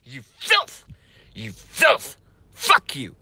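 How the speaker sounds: high, cracking cry of disgust; disgusted shout. Real, not acted. Streamer raging at Hillary's campaign manager, high disgusted shout. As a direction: spit the insult out, pitch far up.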